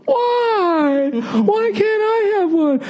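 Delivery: very high, slow, wailing mock cry; hammed-up crybaby wail; whiny fake crying. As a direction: wail it like a sulking child. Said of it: "every time you talk like that".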